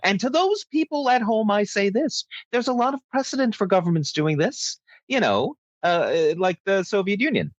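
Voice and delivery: sarcastic grand address to folks at home; mock statesman address. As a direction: play a pompous anchor addressing the viewers, tongue in cheek.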